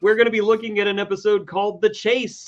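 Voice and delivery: hammed-up next-week promo read; dramatic episode preview. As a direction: build to a swooping high reveal, then hush.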